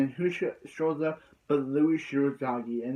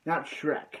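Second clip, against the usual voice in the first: ordinary delivery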